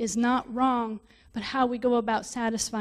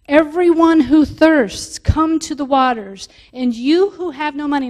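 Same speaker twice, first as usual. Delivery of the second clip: louder, slower, fervent call outward; rising excitement; 3 semitones higher, 8 dB louder than usual. Real, not acted. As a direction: proclaim it like an open invitation. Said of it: "This is where I start to get kind of excited".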